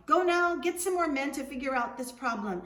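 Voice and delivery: loud, high, breezy call; calling after Juan, carefree; yelling out. Acted. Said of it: "he yelled out to Juan".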